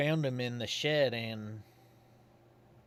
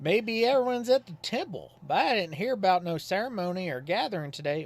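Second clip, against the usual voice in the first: put-on folksy old Godfrey; 8 semitones higher, 5 dB louder than usual. Godfrey calls at Susie's house, folksy and higher. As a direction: play him as a simple, cheerful Southern townsman.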